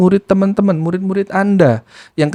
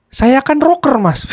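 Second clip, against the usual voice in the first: high-pitched, earnest mimicry; imitated self-declared rocker; 6 semitones higher, slower than usual. Nephew explaining his afternoon yelling, imitated high. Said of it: "jawabannya begitu".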